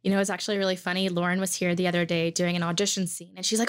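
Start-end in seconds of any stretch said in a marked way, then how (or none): none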